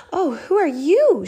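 high, loud startled question; startled to see a stranger; surprised. A narrator's reading. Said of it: "she asked".

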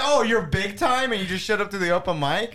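mock him with a high sarcastic voice; sarcastic mock question.

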